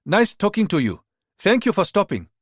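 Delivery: cheerful goodbye with a Ghanaian lilt; Ghanaian accent. Acted. Rita in disguise thanking the mother, Ghanaian accent. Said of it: "staying with the Ghanaian accent".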